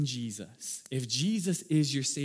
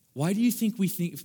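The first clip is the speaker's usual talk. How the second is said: ordinary talk, nothing marked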